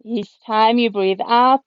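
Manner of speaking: ordinary talk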